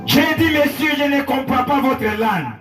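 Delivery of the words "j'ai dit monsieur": ordinary delivery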